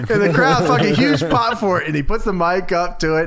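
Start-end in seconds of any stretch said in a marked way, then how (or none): none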